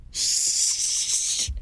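unvoiced hushing shh; sharp whispered shh; hushing gasp. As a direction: hush her quickly between laughs. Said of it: "Cece would gasp through her laughter".

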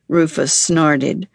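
ordinary delivery

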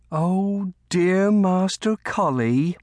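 high, drawn-out fond sigh of words; rueful fondness. A narrator's reading. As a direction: slowly pity him with a rueful smile. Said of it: "could not hold back a rueful smile".

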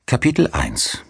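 ordinary delivery, nothing marked

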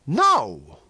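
loud, high-pitched refusal; defiant. A narrator's reading. Venters refuses Toll's last demand, defiant. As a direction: fling it back defiantly.

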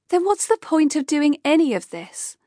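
Kay snapping at Rupert, frustrated and sharp; snapped. Acted. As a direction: bite back at him in frustration. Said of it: "Kay snapped".

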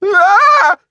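short, high, loud scream. A narrator's reading. Shivers finds clams on his eyebrows, screaming. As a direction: scream out in sudden shock. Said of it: "he screamed".

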